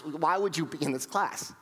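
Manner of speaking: usual speaking voice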